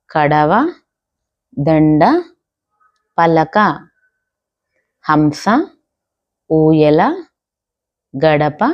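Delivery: each word said slowly and clearly, with a pause after it; slow word dictation. Shown as a demonstration. Teacher reading words for children to repeat, slow clear dictation.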